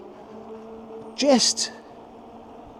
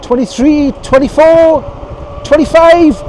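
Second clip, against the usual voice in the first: calling out speed; 9 semitones higher, 9 dB louder, slower than usual. Real, not acted.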